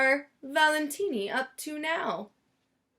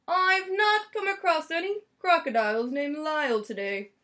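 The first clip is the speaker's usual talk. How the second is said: polite salesman; 4 semitones higher than usual. A narrator's reading. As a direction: answer the lady courteously. Salesman replying.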